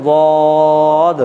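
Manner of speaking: বদ drawn out on a long round "o"; rounded mouth. Shown as a demonstration. Teacher leading students on the next letter, rounded mouth. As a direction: hold the round vowel of বদ slowly before the d. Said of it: "একই রকমভাবে লক্ষ্য রাখতে হবে".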